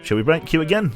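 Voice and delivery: normal talking voice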